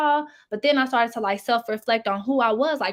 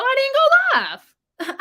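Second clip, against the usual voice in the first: mock sing-song squeal, much higher and louder; playful squeal; 6 dB louder, much slower than usual. Real, not acted. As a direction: squeal it out high and slow, teasing. Playful about skipping livestream.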